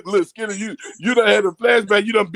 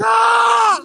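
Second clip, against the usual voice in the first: sharp, much higher mock scream of pain; retold pain scream; 7 dB louder than usual. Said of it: "And I was".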